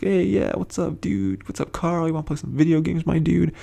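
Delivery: mock a chummy pal greeting his buddy; over-friendly buddy. Ron cozying up to Carl, over-friendly and chummy.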